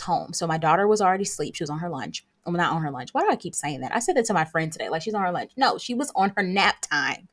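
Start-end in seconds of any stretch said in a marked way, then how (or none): none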